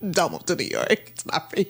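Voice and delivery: low, soft mock warning; a low hushed put-down; softly scolding. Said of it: "mock New Yorker warning".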